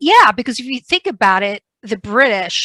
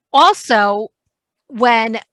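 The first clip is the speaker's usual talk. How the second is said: ordinary delivery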